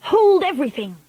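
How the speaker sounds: high, sharp cry; shrieking. A narrator's reading. Witch stopping the crowd with a shriek. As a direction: shriek to stop the room. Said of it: "Her shrieking voice echoed".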